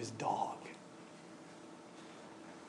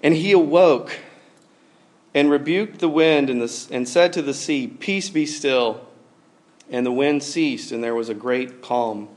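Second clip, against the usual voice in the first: solemn, louder Bible reading; scripture reading; 4 dB louder than usual. Acted. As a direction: proclaim the verse. Reads scripture aloud.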